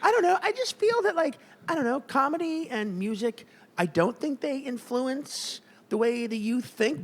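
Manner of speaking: tentative LA-speak stretched into rising endings; LA accent. Acted. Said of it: "your L A accent".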